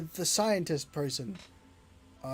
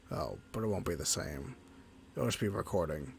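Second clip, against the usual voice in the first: lower, softer sombre creature; low companion voice; 7 semitones lower, 8 dB quieter than usual. Acted. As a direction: voice the lonely companion, low and subdued.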